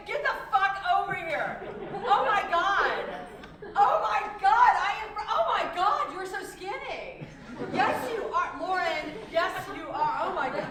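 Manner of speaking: loud, laughing reunion shrieks; excited squealing. Acted. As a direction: scream with delight at an old friend.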